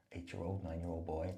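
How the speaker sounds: ordinary delivery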